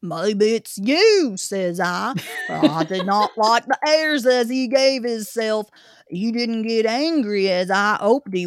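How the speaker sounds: put-on gruff old Cockney zookeeper; Cockney accent. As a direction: grumble it like a London keeper. Zookeeper continues.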